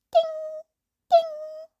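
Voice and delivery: high bright chime imitated twice; doorbell-like ding ding; ding sound. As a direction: sing out a two-note notification ding. Phone notification sound.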